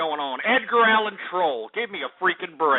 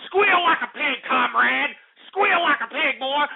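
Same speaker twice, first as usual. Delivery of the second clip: a lightly voiced menacing order, pitched high; host mocking a caller as a commie torturer; mock commie thug; 6 semitones higher than usual. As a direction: sneer it like a cartoon interrogator. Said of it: "communist system to get back you and tell you".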